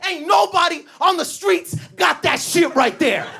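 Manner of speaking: high, hollered hype; hammed-up shouted boast; turned-up sales yell. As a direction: imitate a salesman cranking up the hard sell. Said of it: "he turns it up".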